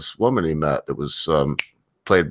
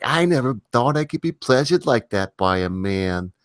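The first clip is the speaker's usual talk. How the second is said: higher-pitched, heavy Brooklyn woman; heavy Brooklyn accent; 6 semitones higher than usual. Retold or imitated. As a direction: put on a thick Brooklyn accent for her. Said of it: "really heavy Brooklyn accent".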